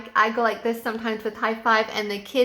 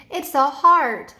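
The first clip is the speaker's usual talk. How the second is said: slow and louder, each word clear; modeling the answer; 4 semitones higher, 6 dB louder, slower than usual. Shown as a demonstration. Teacher giving the answer to a stuck child, slow and loud. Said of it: "if they don't know it".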